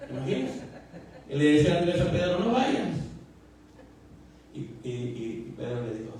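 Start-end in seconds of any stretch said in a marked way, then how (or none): 2.4-3.0 high, pressing no; urgent plea. Retold or imitated. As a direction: stop him from going. [name] warns [name] again.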